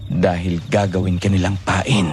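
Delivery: low, slow and ominous; grave revelation. Acted. Captor tells Sofia she will be bait, grave. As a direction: reveal the danger slowly, in a low voice.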